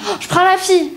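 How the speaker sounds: put-on eager little girl. Eager child picks character.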